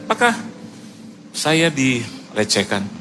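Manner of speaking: ordinary talk, nothing marked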